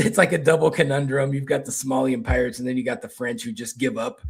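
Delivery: ordinary talk, nothing marked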